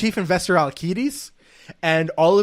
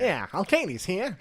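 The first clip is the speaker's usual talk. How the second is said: gangster voice; 5 semitones higher, slower than usual. Retold or imitated. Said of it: "Like gangster".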